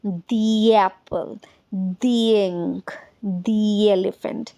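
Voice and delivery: "दी" linked slowly to each vowel word; "दी" before vowel, said slowly; slow pronunciation demo. Shown as a demonstration. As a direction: model "दी एप्पल" slowly for learners. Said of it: "इसकी pronunciation हो जाती है दी".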